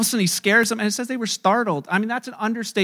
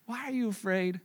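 normal talking voice

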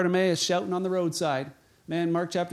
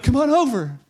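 high, quickly louder acted urging; eager calling over; 5 semitones higher, 6 dB louder than usual. Same crowd now calls the blind man over, eager urging. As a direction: hurry someone along excitedly across a crowd.

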